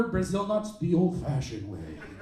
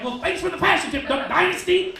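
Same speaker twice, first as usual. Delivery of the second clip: sarcastic comeback; 9 semitones higher, 6 dB louder than usual. Retold or imitated. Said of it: "I'm like".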